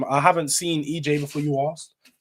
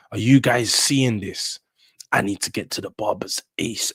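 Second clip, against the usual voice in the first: ordinary talk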